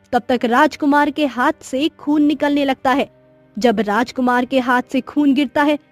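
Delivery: plain reading voice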